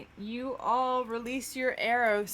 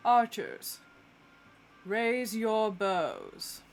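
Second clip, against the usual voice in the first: commanding the archers; much slower than usual. Acted. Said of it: "and goes".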